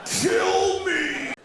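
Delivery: hammed-up anguished cry; despairing seal. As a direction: beg like a miserable captive seal. Seal's imagined words.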